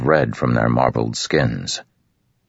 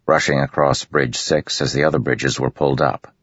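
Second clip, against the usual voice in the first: ordinary delivery, nothing marked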